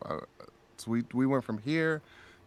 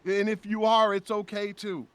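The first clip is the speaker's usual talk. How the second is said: high, fervent public address; raised-pitch, heartfelt proclamation; impassioned stage speech; 9 semitones higher than usual. Retold or imitated. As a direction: declare it with feeling to a crowd.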